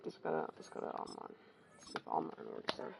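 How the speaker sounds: soft unvoiced muttering; whispered mutter. Real, not acted. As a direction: whisper it to yourself. Muttering to himself while watching.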